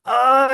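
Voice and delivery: loud, high mock wail; imitated "ah" outburst. Fan reacting to a refused drink, exaggerated high wail. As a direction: cry out "ah" like an overwrought fan. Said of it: "he keeps doing that before everything".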